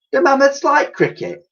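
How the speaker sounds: very high put-on Dotty voice; Dotty asking a naive question, very high-voiced. A narrator's reading. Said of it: "asked Dotty".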